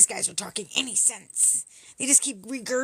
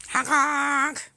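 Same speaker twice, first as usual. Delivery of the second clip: sneering horn noise; mock honking; 3 semitones higher, 3 dB louder than usual. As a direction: honk honk, voiced like a horn. Mocking convoy honkers.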